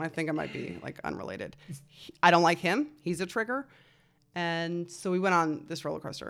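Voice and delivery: usual speaking voice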